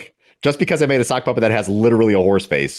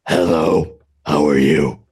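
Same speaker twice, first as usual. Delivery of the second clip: odd put-on leader voice, lower and louder; strange voice; 6 semitones lower, 4 dB louder, much slower than usual. Leader greets the team in a strange put-on voice. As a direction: greet them in a weird alien leader voice. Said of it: "talking in strange voices".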